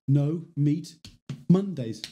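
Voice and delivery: deliberate slogan impression with long gaps. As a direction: space it out word by word. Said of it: "The one I need to memorize".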